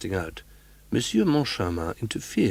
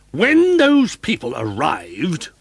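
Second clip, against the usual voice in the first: roaring manager; 7 semitones higher, 7 dB louder, much slower than usual. A narrator's reading. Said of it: "roared Richard".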